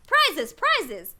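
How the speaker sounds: high loud excited cries; the whole party crowding round Alice, shouting; calling out. Acted. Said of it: "calling out in a confused way".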